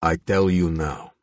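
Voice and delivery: put-on slow, heavy Slavic accent; glaring, slow Slavic accent. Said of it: "deep, rich Slavic accent".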